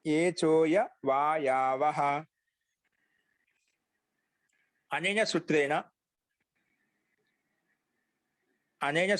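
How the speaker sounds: low, drawn-out Sanskrit recitation for note-taking; slow dictation. Acted. Teacher giving students the next sutra to write, slow dictation.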